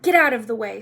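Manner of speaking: loud, abrupt command; snapped. A narrator's reading. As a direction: snap it out impatiently. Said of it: "snapped Ron".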